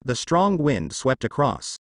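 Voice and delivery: plain reading voice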